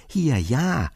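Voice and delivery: ordinary delivery, nothing marked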